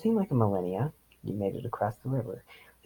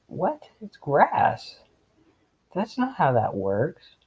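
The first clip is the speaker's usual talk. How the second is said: incredulous; 7 semitones higher, 5 dB louder, slower than usual. Real, not acted. Reader scoffs at story logic.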